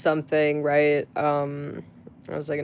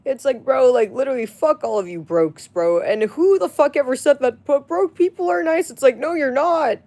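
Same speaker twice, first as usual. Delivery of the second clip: furious rant; 9 semitones higher, 5 dB louder than usual. Real, not acted. Ranting at broke commenters.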